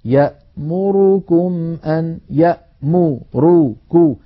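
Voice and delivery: يأمركم drawn out with equal vowels; slow even recitation. Shown as a demonstration. Teacher repeats the word to show even timing. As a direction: recite يأمركم slowly and evenly. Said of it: "يجب أن تكون أزمنتها متساوية".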